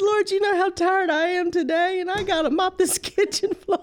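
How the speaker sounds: tired half-laughing plea to God; woman recalling her weary prayer over a sticky floor, laughing high; laugh-crying prayer. Retold or imitated. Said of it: "I start crying".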